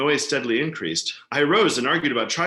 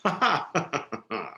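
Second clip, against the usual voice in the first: sinister chuckle; 4 semitones higher than usual. Acted. Killer laughs at his cleverness.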